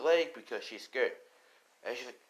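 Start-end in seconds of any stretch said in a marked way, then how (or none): none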